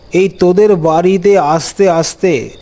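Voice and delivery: ordinary delivery, nothing marked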